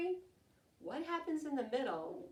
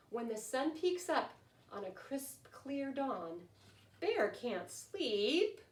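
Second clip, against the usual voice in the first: lively, sing-song picture-book reading, louder than her chat; storytelling to children; 7 dB louder than usual. A narrator's reading. Teacher reading a rhyming bedtime book to her class, lively storytelling. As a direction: read it like story time for little ones.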